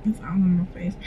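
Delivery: whispered, under the breath; whispered aside. Real, not acted. Aside to viewers about her face, whispered. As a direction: whisper it as a quick private aside.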